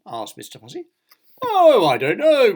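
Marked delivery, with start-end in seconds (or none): 1.4-2.5 high, loud, drawn-out breezy reply; grinning. A narrator's reading. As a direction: stretch it out with a carefree grin. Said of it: "grinned Mister Clumsy".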